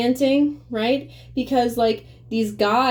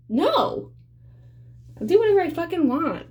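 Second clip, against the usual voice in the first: much higher, fast defiance; defiant retort; 10 semitones higher than usual. Retold or imitated. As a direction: toss it back, high and quick.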